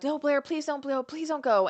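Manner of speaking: high-pitched imitated plea; mock begging. Friends begging as she packs everything, mock pleading.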